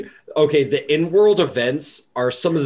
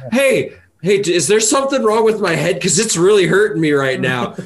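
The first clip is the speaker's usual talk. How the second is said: dazed Viking; 5 semitones higher, 3 dB louder than usual. Retold or imitated. Voicing axe-head Viking NPC.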